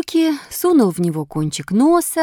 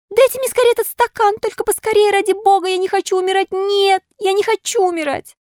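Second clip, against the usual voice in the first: high-pitched frantic puppet pleading; panicked crying out; 4 dB louder than usual. A narrator's reading. Puppet begging for medicine before he dies, panicked. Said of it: "закричал поэт".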